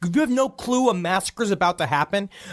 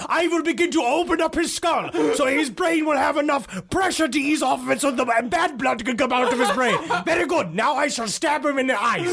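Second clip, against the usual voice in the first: mock old-timey doctor; 7 semitones higher than usual.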